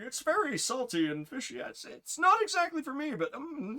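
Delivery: high-pitched diplomatic hedging; imitating reluctant politeness in a high voice; mock polite reviewer. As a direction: tiptoe round the criticism, high-voiced.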